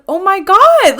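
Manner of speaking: high and loud, overjoyed; delighted shriek. Retold or imitated. To the musician about his stuffed dog, delighted shriek. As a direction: burst out with delight.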